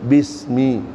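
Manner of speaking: "Bismi" repeated with a clean s; careful teacher's "Bismi" with a hissed s; correct pronunciation. Shown as a demonstration. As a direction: model "Bismi" low, crisp s not th. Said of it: "tapi bismi".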